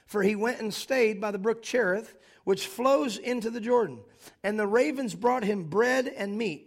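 loud, emphatic scripture preaching. Acted. Pastor preaching to the congregation, loud and emphatic. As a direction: proclaim the text with full force.

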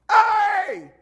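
high, loud joyful yell from far off; hammed-up shout of greeting; imitated yell. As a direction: call out across the mall at full voice. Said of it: "He yells at me".

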